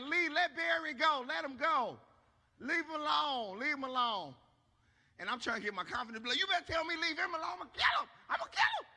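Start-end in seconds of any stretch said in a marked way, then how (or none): 0.0-4.4 high, loud drawn-out motherly holler; imitating mama yelling. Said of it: "'cause my mama like". 6.4-8.9 frantic yell climbing into a squeal; hammed-up bravado. Mock bravado while losing the fight.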